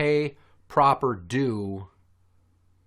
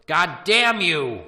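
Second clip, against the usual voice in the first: slow drawn-out mock yell, higher; imitating mother's shout; 5 semitones higher, 7 dB louder, slower than usual. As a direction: bark it out like an annoyed mother. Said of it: "hear my mother shout".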